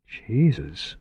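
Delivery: usual speaking voice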